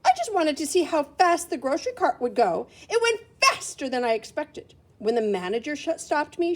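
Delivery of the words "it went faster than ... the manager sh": usual speaking voice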